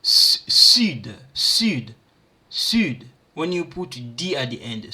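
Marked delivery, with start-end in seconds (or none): 0.0-3.1 "sud" with a rounded French u, first one punched loud. Shown as a demonstration. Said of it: "Here it sound like".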